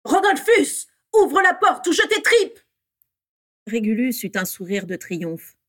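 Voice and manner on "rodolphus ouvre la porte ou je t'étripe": high, loud shouted threat; cri menaçant. A narrator's reading. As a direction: yell it furiously at full pitch. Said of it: "cria Bellatrix". (hurlement aigu et menaçant)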